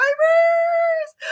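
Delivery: high, loud gasp of disbelief; sharp overjoyed gasp; joyful gasp. Real, not acted. Gasping at the thousand-subscriber news, overjoyed gasp. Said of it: "my emotions come out in my tears".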